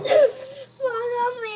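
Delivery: high drawn-out sob; crying for mommy. Real, not acted. Upset child calling her mother, crying. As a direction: wail for mom.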